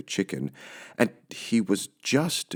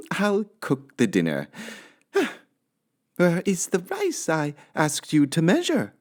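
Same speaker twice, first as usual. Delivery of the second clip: upset Missus Rogers; 9 semitones higher than usual. Acted. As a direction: scold the maid in dismay.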